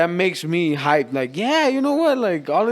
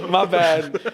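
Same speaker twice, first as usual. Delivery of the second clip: laughing; 5 dB louder than usual. Real, not acted. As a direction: laugh it off sheepishly. Laughs after name slip.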